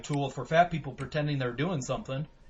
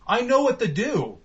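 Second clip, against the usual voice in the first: mock yell; 6 semitones higher, 7 dB louder than usual. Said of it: "I was like".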